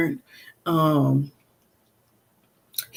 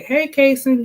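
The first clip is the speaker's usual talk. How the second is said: higher, louder call to get his attention; 9 semitones higher, 4 dB louder than usual. Retold or imitated. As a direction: call his name brightly to catch his ear. Calling son to get attention.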